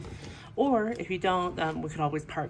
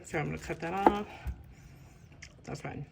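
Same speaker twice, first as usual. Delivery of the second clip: normal talking voice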